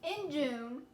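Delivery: loud, emphatic month heading; announced month. A narrator's reading. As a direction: call out the month like a title.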